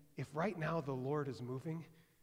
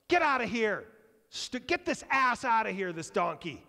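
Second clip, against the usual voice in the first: loud, high shout to get out; angry crowd; 7 semitones higher, 9 dB louder than usual. Retold or imitated. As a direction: chase the donkey off in anger. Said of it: "they're like".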